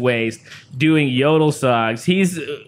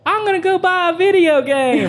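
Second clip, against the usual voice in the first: put-on high kid voice. Kid asking to buy game.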